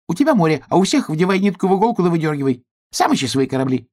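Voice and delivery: high, fast squirrel rattling off complaints; cross squirrel. Acted. Squirrel refuses to help hedgehog, high cross chatter. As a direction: brush him off in a high, hurried voice.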